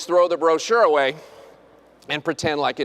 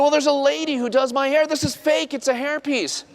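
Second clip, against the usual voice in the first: mock patient voice; 7 semitones higher than usual. Patient explaining, voiced high.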